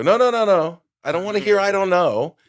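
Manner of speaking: high, loud, refusing to hear it; loud insistent demand. Retold or imitated. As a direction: shout him down, insisting on the truth. Demanding an honest answer from wife.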